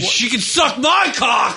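hammed-up slow angry shout from a recorded rant; mock furious yell; Mel Gibson rant. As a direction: bellow it like an unhinged star on tape. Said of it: "what was Mel Gibson's line".

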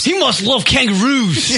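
put-on Australian accent. Host teasing an Australian caller in a mock Australian accent. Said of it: "and did you have to do the accent?".

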